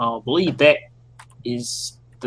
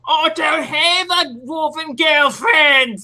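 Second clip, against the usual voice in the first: shouting; 12 semitones higher, slower than usual. Real, not acted. Player shouts back at villain.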